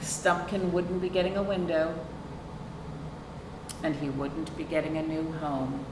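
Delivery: plain reading voice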